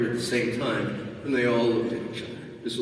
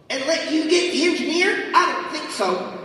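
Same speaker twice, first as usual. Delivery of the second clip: high, smug character voice; 4 dB louder than usual. Acted. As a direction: sneer it back playfully. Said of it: "Genesis said".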